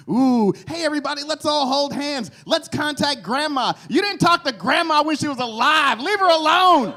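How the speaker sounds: high, loud hammed-up seance voice turning to scolding; mock seance leader. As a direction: parody the eager seance crowd. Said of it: "Then they wanna do a seance".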